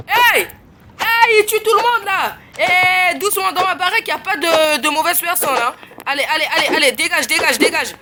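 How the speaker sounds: high, loud yells to drive him out; angry shouting. Real, not acted. Streamer yelling at a killer player in his house, angry and loud. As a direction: chase the killer off angrily.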